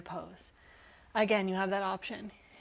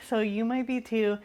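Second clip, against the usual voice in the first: ordinary talk, nothing marked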